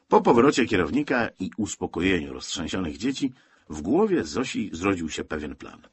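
ordinary delivery

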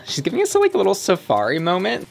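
high, sassy flip of a line; sassy drag queen. Acted. Sassy runway commentary.

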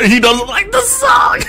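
high, loud startled yell; alarmed shout. Real, not acted. Alarmed as Ronald reacts.

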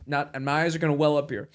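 ordinary talk

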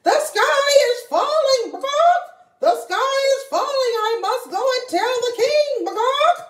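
high, loud frantic hen; panicking hen. Acted. Henny Penny believing the sky falls, high frantic panic. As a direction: squawk the alarm. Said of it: "didn't even stop".

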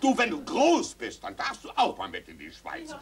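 usual speaking voice

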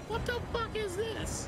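high, slow, stunned question; shocked. Real, not acted. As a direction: squeal it in disbelief. Shocked at the item.

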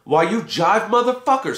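high, furious outburst; Roy screams at the guru in rage; screamed. A narrator's reading. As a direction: explode at the guru. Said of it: "screamed Gimme Some Roy".

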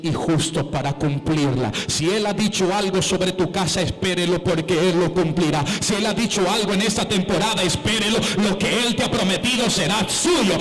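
loud, rising sermon climax; preaching at full force. Acted. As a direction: build the church up to a shout. (predicación a todo pulmón, cada vez más alta)

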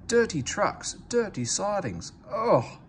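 high, haughty engine sneering at trucks; disdainful James. A narrator's reading. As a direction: turn up your nose at dirty trucks. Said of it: "put in James".

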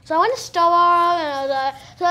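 put-on light LA valley accent. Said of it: "the L A one's like this".

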